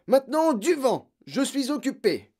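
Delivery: loud, high shouted brush-off; exasperated father yelling; angry dismissal. A narrator's reading. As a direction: shout the child out of your way. Father shouts daughter away.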